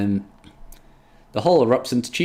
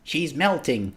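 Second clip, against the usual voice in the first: higher-pitched, lightly hopeful; 5 semitones higher, 3 dB quieter, slower than usual. Acted. Scorpius watching Rose soften, mildly hopeful. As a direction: keep a touch of hope. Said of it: "That's still hopeful".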